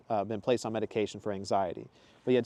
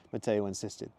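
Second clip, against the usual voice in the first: ordinary delivery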